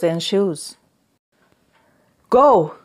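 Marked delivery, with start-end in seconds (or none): 2.3-2.8 loud, high, sharp command; barked order. A narrator's reading. Young master throws the mute out, barking angrily. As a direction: bark it out to banish someone. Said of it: "he barked".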